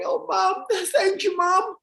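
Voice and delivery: high, tearful, with a laugh; mock crying son. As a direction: blubber thanks to his mom. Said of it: "So Joe starts crying".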